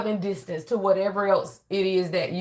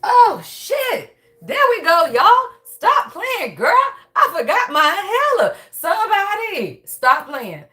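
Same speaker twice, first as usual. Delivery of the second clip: high, loud burst of amused relief; whooping at your own forgetfulness; excited relief; 9 semitones higher, 5 dB louder, slower than usual. Real, not acted. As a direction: exclaim it high and loud, laughing at yourself. Relieved outburst after using her inhaler.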